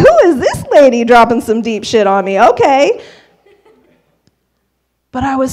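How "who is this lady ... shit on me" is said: loud, incredulous comic disbelief; big hammed-up amazement; astonished reaction. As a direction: exclaim it like you're floored, sassy and pitched up. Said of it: "if you could have seen my face".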